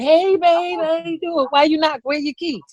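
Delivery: syrupy high welcome to her man; sweet mock greeting. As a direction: play it innocent and cute at the door. Greeting boyfriend at door.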